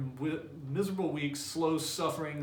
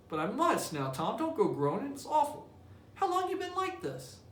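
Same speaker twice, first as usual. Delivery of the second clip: put-on much higher, anxious boy's voice; worried little brother; 11 semitones higher than usual. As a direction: pitch it up high and fret over him. Sid alarmed at Tom.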